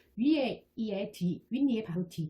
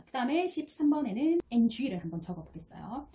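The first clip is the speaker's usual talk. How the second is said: usual speaking voice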